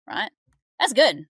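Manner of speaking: ordinary talk, nothing marked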